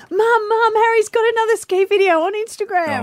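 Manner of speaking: put-on high eager child voice; calling Mum, high and excited; excited kid. Kid's excited voice quoted.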